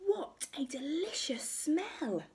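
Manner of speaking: high, airy exclamation; delighted wonder. Acted. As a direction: marvel at a lovely smell. Goldilocks smells the porridge.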